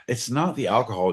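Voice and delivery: normal talking voice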